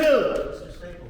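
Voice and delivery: loud, slow call for order; stern call. Real, not acted. Judge calling the defendant to order, stern loud call. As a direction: cut him off firmly. Judge trying to regain control.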